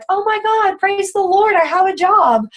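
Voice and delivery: high, mock-elated squeal; a hammed-up praise-the-Lord squeal; mock joy. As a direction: gush like you just got the job. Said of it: "And not out of joy of like".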